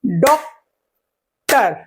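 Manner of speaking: "Doctor" as two clapped-out beats, डॉक, टर; split into syllables. Shown as a demonstration. Teacher counting syllables by clapping, doctor in two separate beats. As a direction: leave a clear gap after डॉक. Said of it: "कि ताली बजा के".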